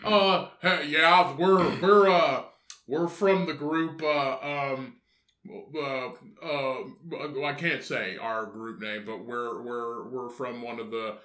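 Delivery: hesitant, evasive caller placing an awkward order; sheepish customer. Acted. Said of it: "It's just like".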